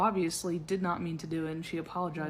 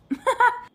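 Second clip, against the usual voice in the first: a squealing laugh, much higher and louder than her talk; 12 dB louder than usual. Real, not acted. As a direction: let the laugh peak high. Laughing at her own joke.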